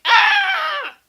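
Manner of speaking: very high, loud scream of panic; panicked scream. Acted. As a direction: let out a sudden, hammed-up panic shriek. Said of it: "I fall into a panic".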